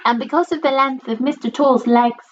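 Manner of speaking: ordinary delivery, nothing marked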